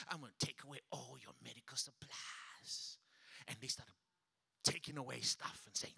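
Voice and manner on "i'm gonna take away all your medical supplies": devil whispering. Retold or imitated. Devil voiced in a story.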